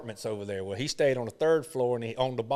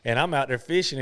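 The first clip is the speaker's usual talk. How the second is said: ordinary talk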